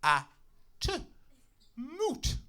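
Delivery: a small daughter, proud of her spelling; child's voice. Retold or imitated. Child spelling word to daddy.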